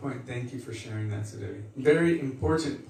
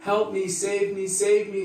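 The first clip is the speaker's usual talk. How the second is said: imitating crying plea; 7 semitones higher than usual. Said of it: "was weeping and crying".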